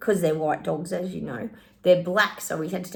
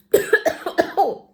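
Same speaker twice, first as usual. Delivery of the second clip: sudden choking cough; coughing up water; 10 semitones higher, 7 dB louder than usual. Real, not acted. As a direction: cough as tea goes down wrong. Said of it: "my tea went down the wrong way".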